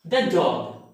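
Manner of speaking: short thuh in "the dog"; "the" without a long ee before "dog"; weak the before consonant. Shown as a demonstration. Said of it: "this word is pronounced".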